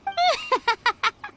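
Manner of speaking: high squeaky awkward laugh; embarrassed laugh. Acted. Ganyu embarrassed by tales.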